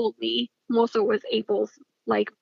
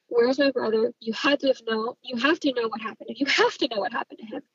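normal talking voice

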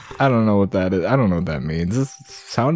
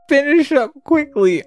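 rat king; 13 semitones higher than usual. Acted. Rat King's order.

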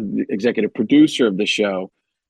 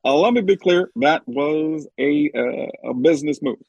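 Barack Obama. Acted. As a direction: stretch it out with measured hesitations. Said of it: "I saw President Obama talk to you".

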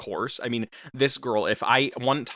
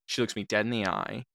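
ordinary delivery

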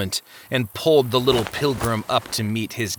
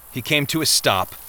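usual speaking voice

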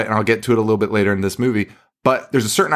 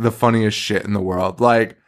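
ordinary delivery

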